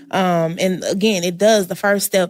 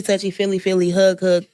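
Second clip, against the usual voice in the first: ordinary talk, nothing marked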